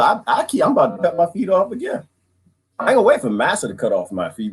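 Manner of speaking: ordinary talk, nothing marked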